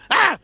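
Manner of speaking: short mock-scared yelp; sudden pop-out scare cry; startled yelp. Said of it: "scaring himself every time".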